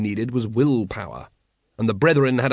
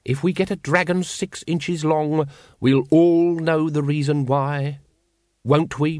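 lightly voiced exasperated leader, pitched higher; a hint of a fussy cult leader scolding; pompous Grand Master; 4 semitones higher than usual. A narrator's reading. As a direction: scold the brethren like an irritated chief.

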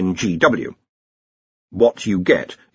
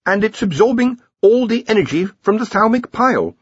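put-on higher, hesitant young wizard explaining; a young researcher stammering out an answer; nervous young wizard; 11 semitones higher than usual. Ponder explaining the energy drain.

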